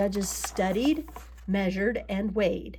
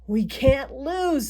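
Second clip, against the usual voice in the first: slow, emphatic boast, pitched up; confident Papa; 4 semitones higher, 5 dB louder, slower than usual. A narrator's reading. Papa boasts about the pumpkin contest, confident. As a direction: stretch it out, sure of winning. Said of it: "said Papa".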